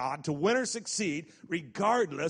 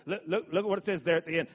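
ordinary delivery

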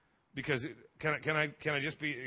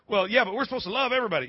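lightly voiced protest, fast and higher; mock objector; 5 semitones higher, 5 dB louder, faster than usual. Preacher voices someone objecting about loving everybody, mock objector. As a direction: rush out a louder, mildly mocking objection.

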